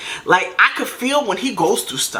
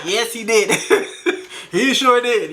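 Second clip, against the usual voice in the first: amused, breaking into a laugh; 3 semitones higher than usual. Real, not acted. Woman recalling people contradicting the cheater's denial, laughing. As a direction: laugh through the words.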